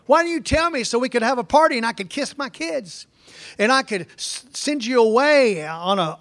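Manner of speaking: high, pleading paraphrase as Laban; whiny Laban. Retold or imitated. Preacher voicing Laban's complaint, high and pleading.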